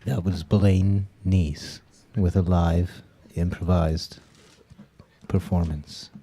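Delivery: slow, drawn-out announcer read; radio host announcement. Real, not acted. Host introduces Blaine after his story, announcer voice. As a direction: present the act like a DJ.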